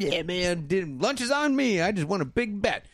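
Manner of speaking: high-pitched mock elation; so happy. Winner telling his friend about the bet, so happy. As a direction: imitate a thrilled winner, voice way up. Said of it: "And he's so happy".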